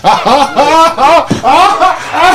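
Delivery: high, drawn-out cackle; real laughter. Real, not acted. Comic tickled by the good Kush line, real laughing fit. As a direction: crack up at your own joke. Cracks up at own Kush joke.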